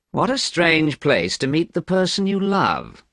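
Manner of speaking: mildly sneering remark; lightly unkind aside. A narrator's reading. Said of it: "he said unkindly".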